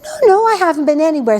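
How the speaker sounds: high, quick innocent denial; Gehazi denying. Retold or imitated. Servant lying to his master, in a high, innocent voice. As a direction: protest too much. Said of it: "And he goes".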